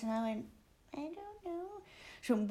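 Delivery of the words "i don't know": tiny, high, soft voice; mock-timid little answer; meek pupil. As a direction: squeak it out quietly like a child in trouble. Acts out cowed pupil answering teacher.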